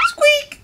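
tiny, very high squeak; mouse squeak. A narrator's reading. Mouse answering Duck, very high squeak.

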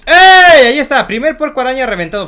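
high, loud victory shout; triumphant cheer. Real, not acted. Gamer celebrates first spider pig defeated, triumphant. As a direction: cheer big, then announce the kill.

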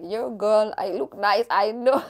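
sassy. Retold or imitated. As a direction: brag with playful sass. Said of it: "I'm like".

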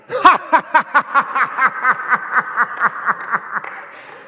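long high forced cackle; hammed-up laugh. Comedian angel laughing at his pun, hammed up. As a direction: laugh too hard at your own pun.

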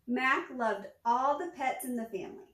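usual speaking voice

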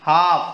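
"हाफ" as haaf, loud, no l; silent l in half. Shown as a demonstration. Teacher calls "हाफ" to the class, l silent. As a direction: call out "हाफ" with no l sound. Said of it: "एल silent".

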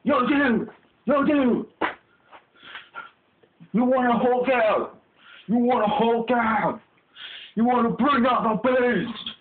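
high, hollered hype chant; amped-up gym buddy. Acted. Buddy urging someone to Hulk out, wildly amped.

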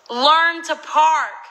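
slow, high, full-volume shout; loud shouted note. Retold or imitated. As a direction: read it out as a drawn-out bellow. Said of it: "why are you so fucking loud".

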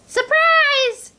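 very high squeaky shout of surprise; spring the surprise like tiny mice; mice's joyful cry. A narrator's reading. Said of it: "cried the mice".

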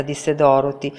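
ordinary delivery

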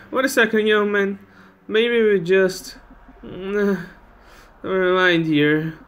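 put-on high, halting old-lady voice; old lady starting a plea, then giving up; hesitant old lady. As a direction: play an old woman hesitantly stopping a guest. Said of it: "it's an old lady".

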